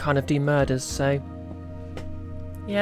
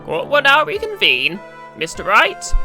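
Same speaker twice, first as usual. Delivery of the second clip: judge; 7 semitones higher, 5 dB louder than usual. Acted. Judge voiced in courtroom.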